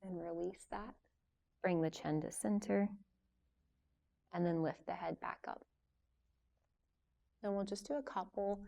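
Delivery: soft, unhurried yoga teacher voice with long pauses; guided yoga instruction. Real, not acted. Yoga teacher leading a class through gentle stretches before relaxation, calm guiding voice. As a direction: guide them softly through each stretch, leaving room to move.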